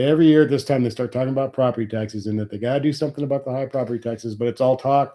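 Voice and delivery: ordinary talk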